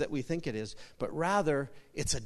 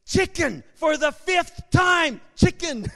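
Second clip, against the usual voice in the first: high, loud, drawn-out yell; exasperated yell; 12 semitones higher, 8 dB louder, slower than usual. Acted. Wife answering her husband for the fifth time, exasperated yell. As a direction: yell it slowly, fed up with repeating yourself. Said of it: "she yells at me".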